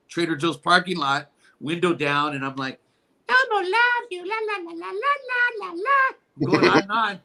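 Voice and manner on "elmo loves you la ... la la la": very high squeaky puppet voice; sing-song la-la in a tiny falsetto; Elmo. Retold or imitated. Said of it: "in your Elmo voice".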